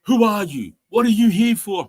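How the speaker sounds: high, loud broken English challenge; mock yelling official in broken English. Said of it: "it would be broken English".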